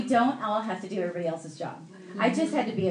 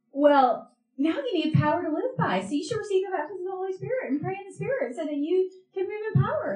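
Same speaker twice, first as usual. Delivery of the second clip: high, eager mimicked teaching; earnest teacher friend; 10 semitones higher than usual. Elizabeth instructs new believer, high and earnest. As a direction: pitch it up and press on briskly.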